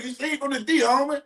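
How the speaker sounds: high, bouncy callout of the comment; playful callout. Real, not acted. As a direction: toss it out high and teasing. Reading a viewer's comment aloud.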